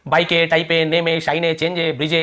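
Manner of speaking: "बाइक", "टाइप", "नेम" each ending in an extra -ay; silent E wrongly sounded. Shown as a demonstration. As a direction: add an "e" sound after each word, बाइक to ब्रिज. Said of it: "ऐसे तो नहीं पढ़ रहे ना".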